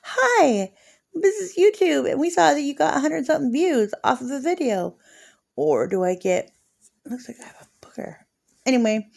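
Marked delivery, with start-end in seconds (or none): 0.0-5.0 much higher, quick official voice; spoofing a YouTube notification voice; mock YouTube message. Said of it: "Or a". 7.1-8.2 whispered quick aside. Real, not acted.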